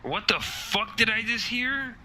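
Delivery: high, slow stunned outburst; shocked. Acted. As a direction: blurt it out in disbelief. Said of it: "And I would say that aloud".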